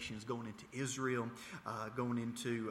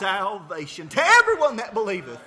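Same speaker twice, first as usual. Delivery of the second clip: preaching at full force; 8 semitones higher, 15 dB louder than usual. Acted. Preacher proclaiming scripture.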